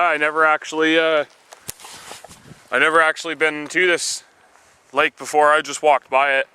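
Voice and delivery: normal talking voice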